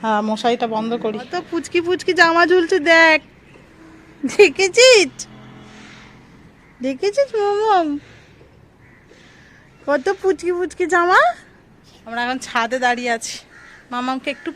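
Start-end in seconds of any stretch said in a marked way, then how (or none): 1.2-11.2 slow, higher cooing over tiny clothes. Real, not acted. Showing tiny clothes to daughter.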